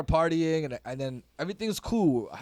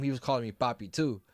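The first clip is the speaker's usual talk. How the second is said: ordinary delivery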